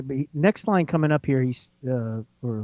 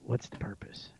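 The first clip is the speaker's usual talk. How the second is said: whispering; 13 dB quieter than usual. Retold or imitated. Echoing the reverse clip softly.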